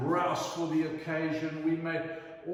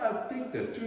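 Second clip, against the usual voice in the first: higher, lightly voiced posh old Scottish lady asking a price; a hint of a grand lady enquiring; voicing the Scottish lady; 10 semitones higher than usual.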